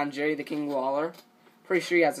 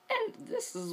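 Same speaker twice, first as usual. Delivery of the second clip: voice cracking; 6 dB quieter than usual. Real, not acted. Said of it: "now my voice is starting to crack".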